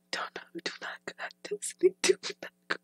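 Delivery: real unvoiced whisper to oneself; whispered self-warning. Real, not acted. Vlogger stopping themselves going back to this, whispered. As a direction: whisper it urgently under your breath, quickened at first.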